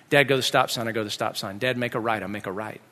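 low, soft run through directions; subdued, orderly retelling; calm retry. Retold or imitated. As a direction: recite order and echo obediently.

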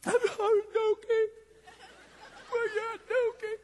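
put-on seven-year-old crying for his cat; tearful pleading. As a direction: whimper it like a sobbing kid. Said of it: "starting to break down".

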